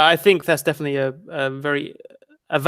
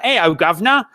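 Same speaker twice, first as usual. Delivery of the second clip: mock Cockney accent; 3 semitones higher, 3 dB louder than usual. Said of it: "Mary Poppins, like".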